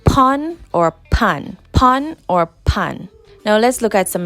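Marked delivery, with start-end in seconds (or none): 0.0-1.6 pon and pan said slowly and clearly, higher; teacher modelling pon or pan; slow patois demo. Shown as a demonstration. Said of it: "On in Jamaican patois is". 1.7-3.1 pon or pan repeated slowly; teacher repeating pon or pan; repeat patois demo. Shown as a demonstration. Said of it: "On in Jamaican patois is".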